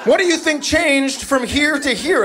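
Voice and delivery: high-pitched comic exasperation; exasperated dad. Acted. Dad answering son in the canoe, exasperated. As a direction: answer in a high, fed-up rant.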